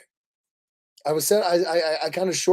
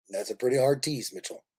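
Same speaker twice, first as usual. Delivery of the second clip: usual speaking voice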